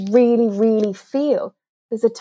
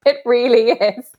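real laughter breaking through the words; high, giggly agreement, helpless with amusement; laughing; 5 semitones higher, 3 dB louder than usual. Real, not acted. Coach agreeing with host about fight starters, laughing. Laughs at co-host's dry remark.

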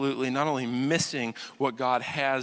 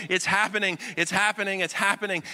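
excited daydream; 6 semitones higher, faster than usual. Retold or imitated. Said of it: "they're daydreaming and going".

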